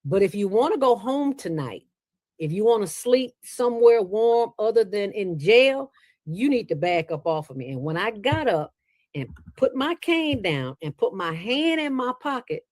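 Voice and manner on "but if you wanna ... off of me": higher, firm warning to back off; a mild stern warning, a bit higher. Retold or imitated. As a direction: put him on notice, woman to man. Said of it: "And I said".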